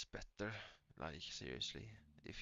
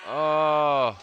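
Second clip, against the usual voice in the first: shout; 7 semitones higher, 24 dB louder than usual. Real, not acted. Reacting loudly to a clip.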